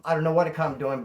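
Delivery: normal talking voice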